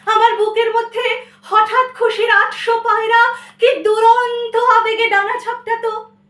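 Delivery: high, loud surge of feeling; impassioned poetry recitation. Acted. Child overjoyed at mother's song, soaring recitation. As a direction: burst out as if the heart takes flight. Recites sudden burst of joy.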